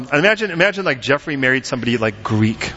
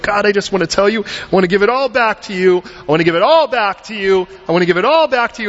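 higher-pitched, insistent repeated plea; earnest surrender plea; 8 semitones higher, 3 dB louder than usual. Acted. Believer handing it all back to God, higher-pitched pleading.

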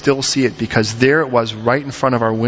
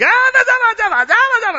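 very high, squawking gibberish; mock screamer; 4 dB louder than usual. Neighbor wife yelling at her husband, mock high screeching. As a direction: imitate a screeching yeller in nonsense syllables. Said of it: "She was a screamer".

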